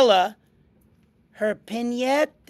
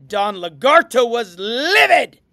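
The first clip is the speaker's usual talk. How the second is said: plain reading voice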